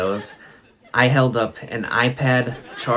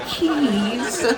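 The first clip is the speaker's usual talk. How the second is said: much higher laughing keys; imitating her question; 14 semitones higher than usual. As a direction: squeak the question like a little child. Quoting her puzzled question.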